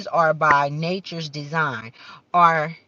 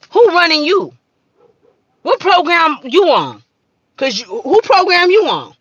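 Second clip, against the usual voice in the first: high, loud demanding questions; loud challenge; 11 semitones higher, 10 dB louder than usual. Real, not acted. Confronting viewers about who programs them, loud and high. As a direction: jab each question at them.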